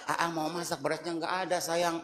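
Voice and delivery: soft, low sweet asking; gentle request. Shown as a demonstration. Wife gently telling husband there's no rice, soft and low. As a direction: ask softly, sweetly, no anger. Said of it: "Nggak usah pakai gitulah".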